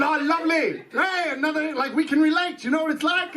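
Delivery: high-pitched mock-friendly welcome; [name]. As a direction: play the eager fellow newcomer, pitched well up. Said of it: "I was [name]. I said".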